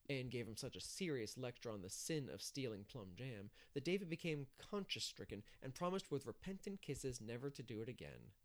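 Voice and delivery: plain reading voice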